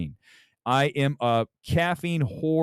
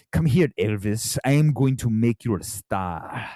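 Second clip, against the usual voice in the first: imitating Tom Hanks; 3 semitones lower than usual. Streamer showing how awful Hanks sounded in Elvis, mock accent. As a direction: put on a mock movie-mentor accent. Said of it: "I don't know what that was".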